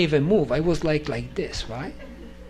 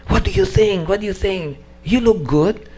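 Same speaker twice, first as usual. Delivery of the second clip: higher, louder teasing friend; 5 semitones higher, 4 dB louder than usual. Retold or imitated. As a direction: needle him playfully. Friend teasing after darshan.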